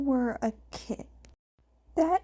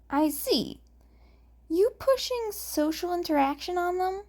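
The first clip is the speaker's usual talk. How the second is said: smirking; 7 semitones higher than usual. A narrator's reading. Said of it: "Hisashi said with a smirk".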